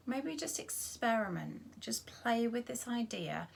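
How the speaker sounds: gentle meditation voice with long pauses; guided body scan. Acted. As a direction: guide them unhurriedly through the body, leaving space after each phrase.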